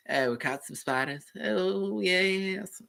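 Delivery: a cartoon character from Family Guy, up high; Family Guy character impression. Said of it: "That character from Family Guy".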